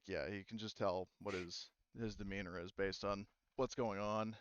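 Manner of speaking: ordinary delivery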